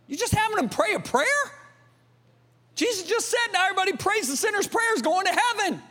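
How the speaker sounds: very high-pitched preacher's challenge; high, mocking rhetorical protest; incredulous challenge. Real, not acted. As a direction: push it way up high in disbelief. Preacher mocking formula prayer salvation.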